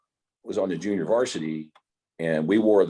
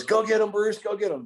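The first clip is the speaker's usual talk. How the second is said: excited cheer. Retold or imitated. Friend cheering Bruce on.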